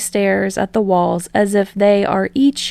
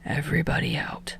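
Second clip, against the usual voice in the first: whispered order; 3 semitones lower, 9 dB quieter, faster than usual. Acted. Aizawa ordering class.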